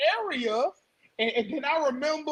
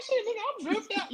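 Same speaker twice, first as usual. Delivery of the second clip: high, soft, showing off; cocky boast; 9 semitones higher, 7 dB quieter than usual. Retold or imitated. Boasting to the stranger about his outfit, high and cocky. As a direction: brag about the fit, pitched up. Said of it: "I'm like".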